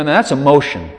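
ordinary delivery

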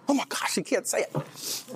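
high, hushed comic disbelief; playful slow gasp of disbelief on the edge of laughter; mock amazement. Joking at unbeliever unable to say it.

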